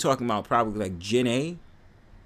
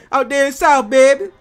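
put-on New Orleans accent; 11 dB louder than usual. New Yorker imitating New Orleans locals, loud high Southern accent. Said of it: "New Orleans be seeming like".

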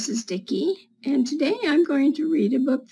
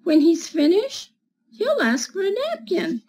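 higher, lively picture-book reading; reading to children; 5 semitones higher than usual. Acted. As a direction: read it like storytime for little kids.

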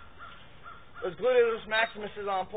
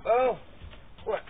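ordinary talk, nothing marked

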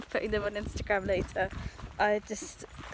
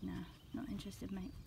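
low, soft, dismissive mumble for the dog; voicing the dog; 6 semitones lower, 12 dB quieter than usual. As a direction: lightly voice an uninterested dog, quiet and low. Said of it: "He's like".

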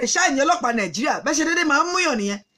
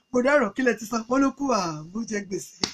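usual speaking voice

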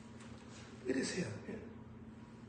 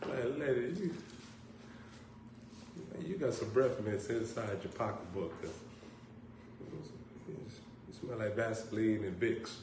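quiet aside; 4 semitones lower, 11 dB quieter than usual. Retold or imitated. Aside insult to lady.

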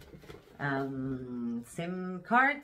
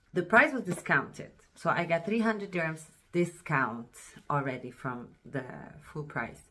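ordinary talk

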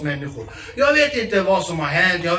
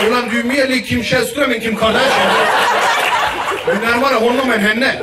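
mock reply; 3 semitones higher, 7 dB louder than usual. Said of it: "Man bara".

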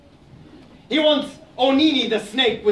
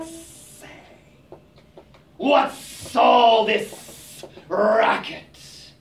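very slow drawling snake voice; sleepy snake; much slower than usual. Acted. The snake, woken by the argument, slow and drawling. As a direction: stretch each word like a waking snake.

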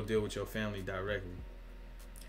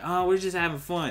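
mock casual; 7 semitones higher, 4 dB louder than usual. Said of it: "it's just like".

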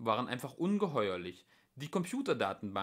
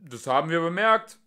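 loud, raised retort; calling out; 6 semitones higher, 12 dB louder than usual. A narrator's reading. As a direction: shout it back drily. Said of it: "rief Ford".

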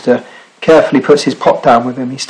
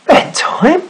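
high-pitched questioning echo, rising sharply; startled question. A narrator's reading. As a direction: query it in disbelief as the sailor. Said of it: "said the sailor".

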